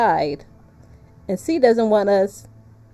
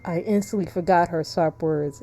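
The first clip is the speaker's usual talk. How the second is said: lower, softer narrator's voice; story narration; 4 semitones lower, 5 dB quieter than usual. A narrator's reading. Player reading the limp towards her, low soft narration. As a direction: read the story line low and measured. Reads story text aloud.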